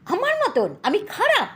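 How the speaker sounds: shrill high outraged shout at husband; furious wife. Acted. Wife rages at husband who compared her to rotten fruit, high furious shout. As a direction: spit it out, outraged. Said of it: "রেগে আগুন".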